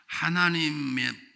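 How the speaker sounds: usual speaking voice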